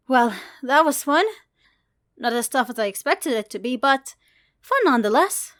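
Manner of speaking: relieved fighter making light of it; giddy after battle. Acted. Saray after fight, laughing exhausted.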